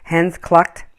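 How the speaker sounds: usual speaking voice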